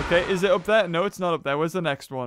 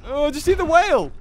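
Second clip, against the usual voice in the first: ordinary delivery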